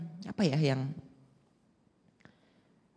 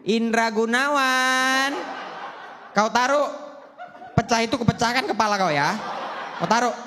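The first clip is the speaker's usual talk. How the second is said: mock furious Batak mother; Batak mom scolding; 5 semitones higher than usual. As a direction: threaten the kid like a fierce Medan mom. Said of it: "mamaku teriak".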